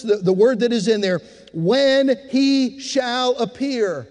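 loud, rousing preacher's cry, high and ringing; full-force preaching. Acted. Preacher rousing his congregation, loud and fervent. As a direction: lift the church to its feet with every line.

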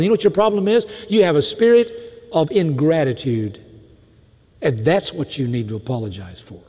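usual speaking voice

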